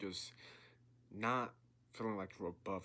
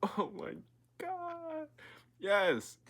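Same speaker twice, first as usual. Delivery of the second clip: laughing, pitch leaps very high on God; overjoyed exclamation; 8 dB louder, much slower than usual. Real, not acted. Moved by the passage he read, a laughing high burst of joy. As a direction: burst out in delighted awe. Overwhelmed after reading passage.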